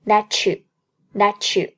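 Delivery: "that you" joined with a ch sound, slowly; t and y of "that you" merged into ch; linked ch sound. Shown as a demonstration. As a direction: run "that you" together like "tha-chu". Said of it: "连成一个ch的音， 读作".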